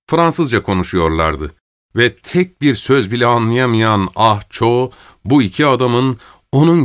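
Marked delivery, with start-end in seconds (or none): none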